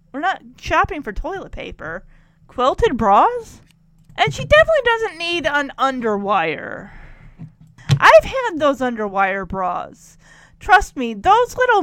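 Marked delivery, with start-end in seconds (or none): none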